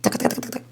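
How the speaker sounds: soft, whispered tapping sounds; hushed imitation of quick paws on the floor; tiny footstep noises. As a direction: tap out the patter of little paws. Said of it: "His little feet".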